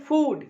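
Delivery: FOOD punched loud; stress on food. Shown as a demonstration. Teacher picks out the stressed word, FOOD punched. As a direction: hit the word "food" hard. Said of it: "give और फूड पे stress आ गया".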